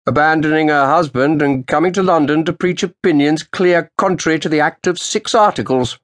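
put-on old official's sharp greeting. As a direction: snap out a cold greeting to the lawyer. Roland's voice.

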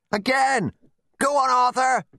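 very high, excited yells; cheering shouts. A narrator's reading. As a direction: cheer someone on from afar. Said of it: "clapping and cheering and shouting from the hedge".

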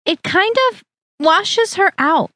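put-on high, slow, irritated fussy older woman; a future mother-in-law faults the bridesmaid, high and slow; irritable Harriet. A narrator's reading.

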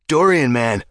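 loud, high, hearty bellow across the market; bellowing greeting. A narrator's reading. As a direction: call out his name with big joy. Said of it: "Edgar bellowed".

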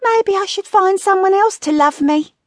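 high, pouty voice; high-pitched sulky self-pity; pouting wife. A narrator's reading. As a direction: muse aloud as a vain, high-voiced wife. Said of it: "Gretel pouted her lips".

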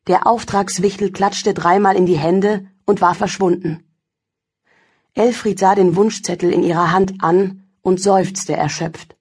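ordinary delivery

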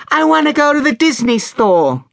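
put-on very high child's demand; little girl insisting, pitched right up; high, pestering child voice; insistent little girl. A narrator's reading.

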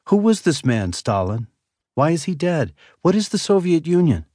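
put-on high, curious little boy; a four-year-old asking his dad; small child voice.

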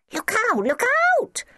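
put-on shrill little children's warning; piercing childish cry; high, shrill. A narrator's reading. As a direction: squeal it out very high. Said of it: "helpfully in high, shrill voices".

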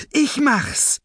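high, giggling villainess; gleeful. A narrator's reading. Eager henchwoman volunteers for the killing, gleeful and high. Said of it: "erbot sich Razzia vergnügt".

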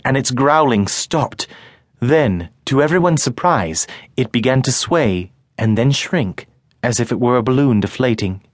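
normal talking voice

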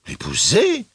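high, shocked question; startled cry. A narrator's reading. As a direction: cry it out with a sharp leap in pitch. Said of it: "s'écria la jeune aveugle". (question stupéfaite, criée dans l'aigu)

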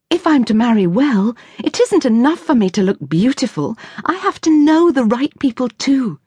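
put-on a keen seventeen-year-old making her case; eager young daughter, lightly voiced; young lady Ruth. Ruth's voice held by narrator.

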